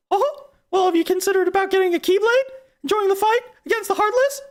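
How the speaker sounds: very high falsetto cartoon mouse; squeaky recruiting pitch with little chuckle; Mickey. Mouse recruits for the fight against the Heartless, squeaky falsetto. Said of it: "Gosh, Mickey".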